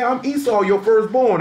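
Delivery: a hint of a desperate firstborn son; insistent Esau. Esau claiming his right.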